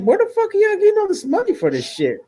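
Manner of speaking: hammed-up squeal of disbelief; high mock indignation; baffled outrage. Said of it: "I'm like".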